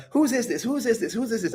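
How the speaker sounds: put-on high, repeated girlfriend demand; imitation of insistent high-pitched questioning; mock pestering. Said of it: "she pushes a couple of times".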